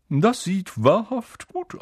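slow, high wistful sigh of a voice; sad ghost. A narrator's reading. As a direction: sound sadly longing for food. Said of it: "sagte der Geist ... traurig". (traurige Geisterstimme)

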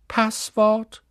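high-pitched questioning lady; high, far-from-natural voice; portrait lady. A narrator's reading. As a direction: ask it like a grand lady guarding a door. Portrait lady asks for password.

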